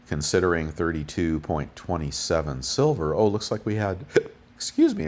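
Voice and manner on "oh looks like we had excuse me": interrupted by a hiccup; hiccuping mid-sentence. Real, not acted. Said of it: "I got the hiccups".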